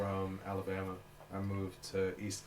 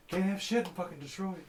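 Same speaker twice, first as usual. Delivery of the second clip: high, raised retold complaint; hammed-up exasperation, pitched far above normal; exasperated outburst; 11 semitones higher, 6 dB louder than usual. Mimicking the friend telling everyone the food is gone, exasperated cry. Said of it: "he was like".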